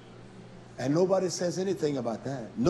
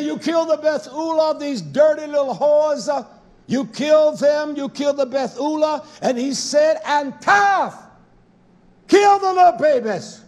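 loud, high preaching shout; thundering divine command; 9 semitones higher, 8 dB louder than usual. Acted. Preacher voicing God's order to kill, thundering.

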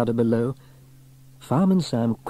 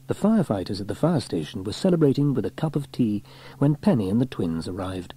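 plain reading voice

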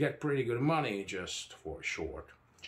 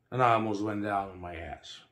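ordinary delivery, nothing marked

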